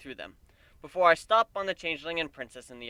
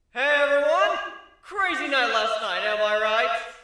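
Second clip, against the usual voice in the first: stage address; 10 semitones higher, 7 dB louder, slower than usual. A narrator's reading. Griffin addressing concert crowd.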